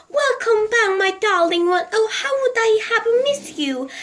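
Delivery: high, delighted welcome to a pet; overjoyed old lady calling out; joyful cry. A narrator's reading. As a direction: gush with joy over the tortoise. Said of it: "clap her hands with joy and cry out".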